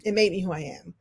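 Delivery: ordinary delivery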